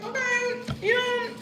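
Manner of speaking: awkward hesitant reply. Retold or imitated. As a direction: squeak out an unsure answer. Her own awkward reply.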